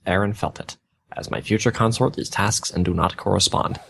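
deadpan dramatic fanfiction reading; mock fanfic reading. Podcaster reading fanfiction to cohost, deadpan storytelling. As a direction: read the bad fanfic aloud with a straight face. Reads fanfiction aloud, mocking.

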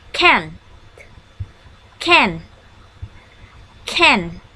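can said slow and clear, one word at a time; model word for repeating. Shown as a demonstration. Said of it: "I want you to repeat after me".

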